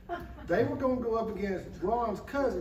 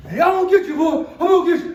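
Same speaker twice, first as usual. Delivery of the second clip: high, very loud mock threat; hammed-up menacing holler; shouted threat; 7 semitones higher, 14 dB louder, much faster than usual. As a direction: yell it like a wrestler after his prey. Wrestler threatens boy, acted out.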